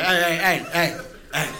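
lightly voiced dog saying hey; idle dog calling hey; bored dog hey. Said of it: "They're just like".

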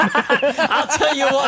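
high, drawn-out commentator catchphrase; Collinsworth impersonation. Acted. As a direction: stretch it out like a TV sports commentator. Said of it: "he goes right into a Collinsworth impersonation".

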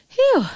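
high "phew"; relieved, high-pitched phew; relieved exhale. A narrator's reading. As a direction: blow out a breath of relief. Relieved girl, tired of shouting.

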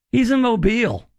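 normal talking voice

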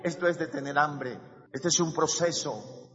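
ordinary talk

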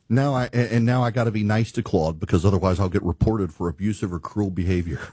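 ordinary talk, nothing marked

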